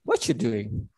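lightly imitated native English, "what you doing" slurred to "whatchu doing"; a quick offhand "Eh, what you doing?" with "are" dropped; casual native speaker. Teacher mimics a native speaker skipping grammar, lightly. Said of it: "tetapi dia bilangnya".

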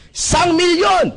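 slow, loud hyped-up announcer yell; mock seminar shout. Seminar speaker hyping the crowd, slow loud mock shout. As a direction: bellow the prize like a hype man. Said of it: "Nagsisigaw lang".

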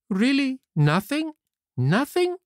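very high, rising questions; pleading puppet Pinocchio. Acted. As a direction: ask in high-pitched disbelief.

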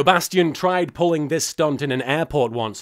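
ordinary talk, nothing marked

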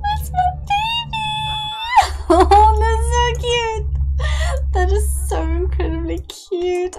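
very high and loud, gushing delight; overjoyed squeal. Real, not acted. Streamer gushing over the newborn Sim, very high and loud.

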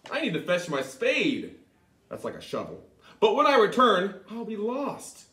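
loud, lively exclaiming; spirited Tom. Acted. Tom worrying how to find the bush again, lively. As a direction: puzzle it out aloud with gusto.

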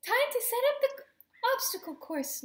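high, eager game announcement; announcing. A narrator's reading. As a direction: announce the next event brightly. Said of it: "Lulu announced".

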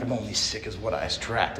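mock the counselor's words quietly; muttered mockery. Leaving treatment, scoffing at the counselor, quietly. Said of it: "I'm like".

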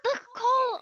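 loud, excited call about coal; excited shout. Real, not acted. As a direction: shout it out, thrilled. Excited find in game.

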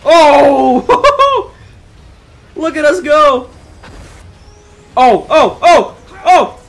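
high, loud whoops with laughter; exhilarated shouting. Real, not acted. Player yelling look at us go, exhilarated whooping. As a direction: whoop and laugh as if on a wild ride. Thrilled flight in game.

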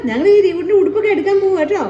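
high, sing-song cooing to a grandchild. Real, not acted. (പേരക്കുട്ടിയോട് കൊഞ്ചി പറയുന്നു)

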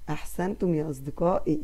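normal talking voice

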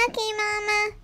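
a hint of a little girl doll; doll's little voice. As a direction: answer sweetly as the toy daughter. Child voices doll answering Mama.

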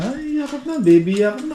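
lilting, high doting voice; cooing pet talk. Real, not acted. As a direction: coo sweetly for a cuddle. Cooing to the dogs for a hug.

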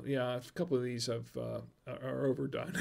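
ordinary delivery

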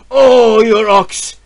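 loud jeering shout; mocking shout. Villagers jeer at the shamed peasant, mocking shout. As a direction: jeer at him across the village. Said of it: "They shouted".

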